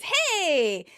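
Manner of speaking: high, drawn-out "hey"; excited. Retold or imitated. Chandler offered a hug by Phoebe, excited. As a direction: imitate an eager, delighted greeting. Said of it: "Chandler gets excited and says".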